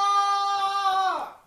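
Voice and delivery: a very high, loud bird-like caw; caw-like yell. Retold or imitated. Brick in the chaos, a sudden loud caw. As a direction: squawk it like a crow. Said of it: "that was more like a caw".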